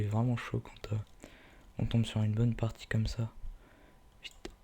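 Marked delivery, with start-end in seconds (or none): none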